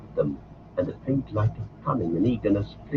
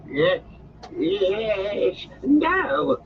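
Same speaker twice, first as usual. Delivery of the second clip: shrieking; 11 semitones higher, much slower than usual. Acted. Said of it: "shrieked Gollum".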